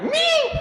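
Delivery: very high, loud squeaked word. Retold or imitated. Nervous boy answering the cheerleader, high loud squeak. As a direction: squeak it out in panic. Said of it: "what I actually did was squeak".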